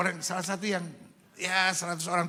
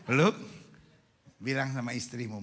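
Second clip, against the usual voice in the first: normal talking voice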